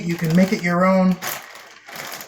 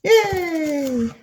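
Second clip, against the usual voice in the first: high playful yay; little cheer; 10 semitones higher than usual. Real, not acted. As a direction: cheer yourself on, pitched way up. Small cheer while cooking.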